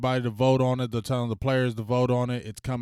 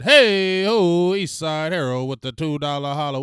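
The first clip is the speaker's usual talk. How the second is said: much louder, higher hey-ho call; hype shoutout; 5 semitones higher, 9 dB louder than usual. Real, not acted. Thanking a donor on stream, loud hype call.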